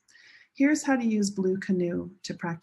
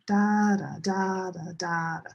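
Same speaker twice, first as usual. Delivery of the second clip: DA-da, DA-da, DA-da, each first da long and strong; stress pattern demo. Shown as a demonstration. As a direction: chant da in strong-weak pairs. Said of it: "They're the same stress pattern".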